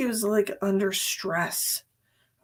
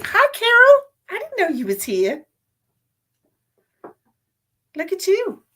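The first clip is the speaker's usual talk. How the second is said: much higher and louder, gushing hello; delighted greeting; 11 semitones higher, 7 dB louder than usual. Real, not acted. Psychic spots a regular viewer, delighted greeting. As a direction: gush over a friend who just arrived.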